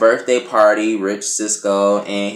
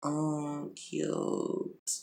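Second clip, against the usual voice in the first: sarcastic cute; 5 semitones higher, 17 dB quieter than usual. Retold or imitated. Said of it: "I'm just like".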